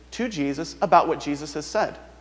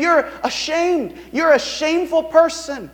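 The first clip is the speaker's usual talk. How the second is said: mocking; 9 semitones higher than usual. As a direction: drag it out with scorn. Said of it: "they're mocking him".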